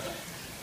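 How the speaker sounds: quiet, breathy trailing laugh; soft chuckle. Real, not acted. Speaker amused at giving his own testimony, soft chuckle. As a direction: let a small real laugh trail off.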